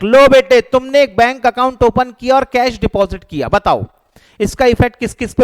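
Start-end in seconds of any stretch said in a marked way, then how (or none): none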